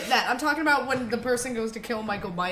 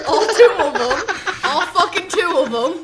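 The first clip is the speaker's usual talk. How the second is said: loud, high, laughing jab; laughing tease; 9 semitones higher, 7 dB louder than usual. Real, not acted. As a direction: laugh it out, teasing. Teasing him, laughing loudly.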